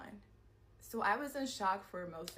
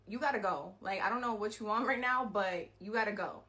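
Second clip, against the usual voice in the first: louder, insistent command; firm order to leave; 6 dB louder than usual. Retold or imitated. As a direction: tell her to get out now. Ordering attacker out of her home.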